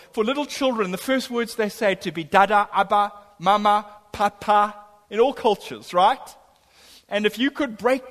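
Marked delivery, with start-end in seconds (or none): none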